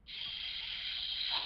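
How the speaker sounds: long soft "shh"; imitated shush, all breath; retold shushing. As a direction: hush her with a drawn-out hiss. Trying to hush the blunt teacher.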